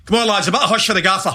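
quick, high, giggling delivery; can't keep a straight face; laughing quote. Retold or imitated. Wee Sandy Stewart hushing the lads for the gaffer, laughing. Teammate's quip, retold laughing.

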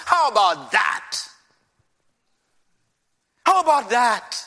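aggrieved protest, mocking God's intent; accusing outcry. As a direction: throw the charge at God, incredulous. Acting the Israelites accusing God.

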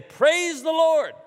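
high, loud, slow praise the Lord; church praise response. Retold or imitated. Congregant at Wednesday praise time, loud and drawn out. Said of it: "you'd hear a few people say".

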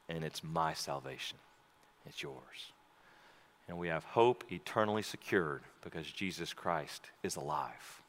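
preacher speaking softly to the congregation; intimate altar call. Acted. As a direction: draw them close, hushed.

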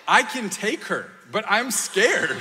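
high, loud comic confession; half-laughing pretend fright; mock scared. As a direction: protest loudly, then stretch out the fear. Jokingly admits fear of his four-year-old.

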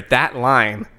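ordinary talk

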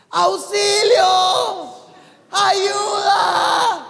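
high, loud, drawn-out yell for help; mock cry for help. Preacher reenacting a friend screaming for help in the sea, loud high yell. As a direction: scream it like someone drowning at sea.

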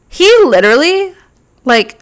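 high, loud outraged burst; indignant high exclamation. Real, not acted. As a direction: spit it out in disbelief. Outraged retelling of driver.

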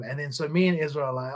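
normal talking voice

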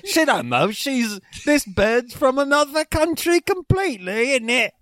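high, hammed-up British accent. Friend riffing as an English bloke about his girlfriend, hammed-up. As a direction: show off a fake English bloke. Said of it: "if you go to London".

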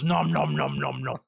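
deep goofy chomping noises; nom nom chomping. Retold or imitated. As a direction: gobble it like a pig. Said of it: "He goes".

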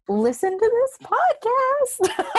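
high, slow, playful promo voice; high announcer voice. Pretend. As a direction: pitch it up like a cheesy ad. Playful promo of their own show.

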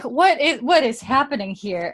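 mock bewildered. Viewers watching the jailbreak, bewildered. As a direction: ask it in baffled disbelief. Listeners' confused reaction retold.

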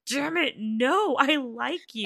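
high, slow, laughing through a mock frustrated plea; slow high-pitched mock despair, breaking into laughter. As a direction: act her frustration, but you can't stop laughing. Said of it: "And Kunsam is like".

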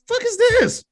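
high, incredulous squeak of disbelief; surprised outburst. Retold or imitated. Reacting to [name]'s cover art, high disbelief. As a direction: recoil at the album cover. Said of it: "I said".

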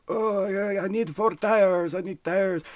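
put-on high, slow old-man voice. Old customer asking the tire shop clerk for tires, high imitated old-man voice. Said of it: "Had an old guy".

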